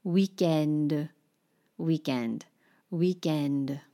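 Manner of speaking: Weekend in a hammed-up French accent, stress on the end; playful French accent drill; exaggerated French accent. Shown as a demonstration. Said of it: "lean into whatever the caricature ... of a French accent".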